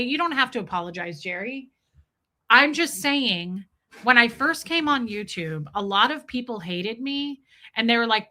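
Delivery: ordinary talk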